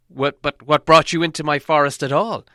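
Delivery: loud, angry demand; the elf king angrily demanding why Thorin came, loud. A narrator's reading. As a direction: lose patience and bark the question. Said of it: "asked the king angrily".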